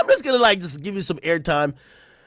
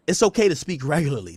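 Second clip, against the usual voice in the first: usual speaking voice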